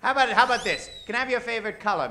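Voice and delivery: normal talking voice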